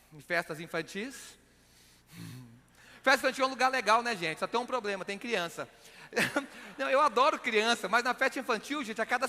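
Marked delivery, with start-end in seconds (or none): none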